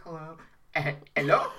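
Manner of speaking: put-on dad's Caribbean accent, lightly done; light Caribbean accent on hello. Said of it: "'Cause my dad's got, like, a thick Caribbean accent".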